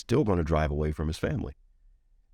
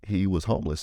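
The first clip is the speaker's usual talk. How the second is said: ordinary talk, nothing marked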